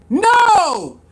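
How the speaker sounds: a sudden shouted no; a short outraged shout; loud no. Real, not acted. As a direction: reject it outright, loud. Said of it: "outraged rejection".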